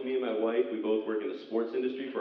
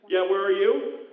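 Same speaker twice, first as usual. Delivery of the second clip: loud, high, confused phone call yelling; loud phone guy; 8 semitones higher, 5 dB louder than usual. Retold or imitated. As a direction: shout into the phone like a lost gambler. Acted phone call at casino.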